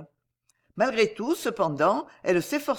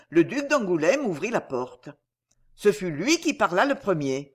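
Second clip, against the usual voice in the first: usual speaking voice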